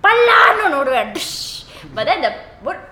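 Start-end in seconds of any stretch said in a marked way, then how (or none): 0.0-1.6 loud, high threatening Indian parent. Retold or imitated. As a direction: shout it like a scolding parent. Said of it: "they will say".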